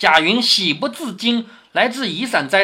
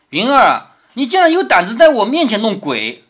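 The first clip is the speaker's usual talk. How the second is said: lofty, imperious lady, pitched up and louder; high-handed teasing accusation; haughty; 4 semitones higher, 5 dB louder than usual. Acted. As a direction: talk down to an underling with a punch. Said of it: "他一说话就是一种高高在上的".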